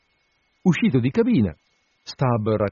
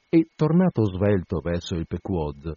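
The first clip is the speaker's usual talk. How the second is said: ordinary delivery, nothing marked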